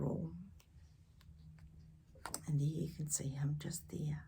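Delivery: hushed, secretive whisper. A narrator's reading. Reader whispering to the child about the scared boy. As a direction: breathe it softly. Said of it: "reads scared part in whisper".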